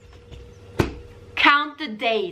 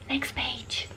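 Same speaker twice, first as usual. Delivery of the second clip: whispered "Next page."; hushed page-turn cue; 14 dB quieter than usual. Acted. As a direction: breathe "Next page." like a secret to the kids.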